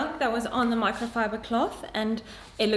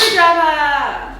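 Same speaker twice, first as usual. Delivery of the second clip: calling out; 3 semitones higher, 10 dB louder, much slower than usual. Real, not acted. Calls out for a tool.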